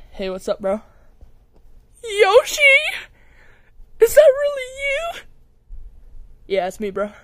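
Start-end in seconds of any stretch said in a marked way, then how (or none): 2.0-5.2 excited fan scream. Acted. Fan meeting Yoshi, screaming.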